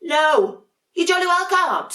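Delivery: high, loud, indignant no; cross refusal. Acted. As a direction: slam the answer at the sisters. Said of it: "said Dudley".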